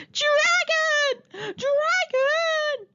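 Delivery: high, loud desperate shout of Dragon; calling for help for real this time; real alarm shout. Real, not acted. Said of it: "there came another shout".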